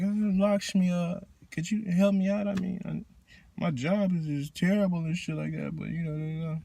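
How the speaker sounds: half-hearted devotee asking Lakshmi, very soft; timid request. Retold or imitated. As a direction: mumble the ask like you barely mean it.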